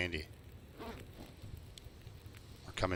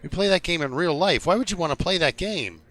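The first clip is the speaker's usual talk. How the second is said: higher-pitched, louder acted disbelief; mock incredulous retort; 8 semitones higher, 4 dB louder than usual. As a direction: play a baffled Canadian protesting. Imagined Canadian retorts to gamer.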